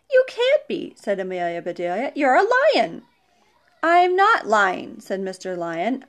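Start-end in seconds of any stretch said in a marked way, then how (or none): 0.1-0.9 very high, loud disbelief; incredulous. A narrator's reading. Disbelieves lion is vegetarian. 2.1-3.0 high, loud protest; incredulous insistence. A narrator's reading. Disbelieves lion is vegetarian. 3.8-5.0 slow, high, loud protest; indignant denial. A narrator's reading. Man protests being called liar.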